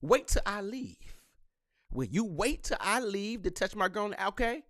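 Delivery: ordinary talk, nothing marked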